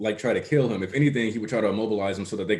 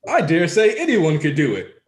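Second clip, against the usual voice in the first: high, louder cocky quote; Bakugo; 7 semitones higher, 5 dB louder than usual. Retold or imitated. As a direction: throw it out high and loud as a boast. Acts Bakugo's bubble line in scene.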